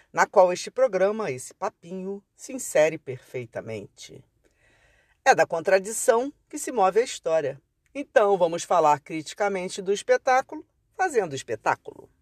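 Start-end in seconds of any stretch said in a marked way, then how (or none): none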